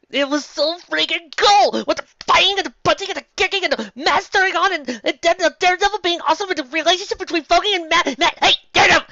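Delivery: very high, loud giddy gushing; squealing fanboy rave. Acted. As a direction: rave like an overexcited fan. Overexcited fanboy rant.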